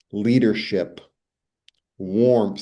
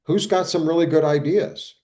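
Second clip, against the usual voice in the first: lightly voiced boss, higher pitch; higher, a touch of a boss inviting input; open-minded leader; 7 semitones higher, 4 dB louder than usual. Good leader asking the board table for ideas, lightly acted. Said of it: "A really good leader sits at the".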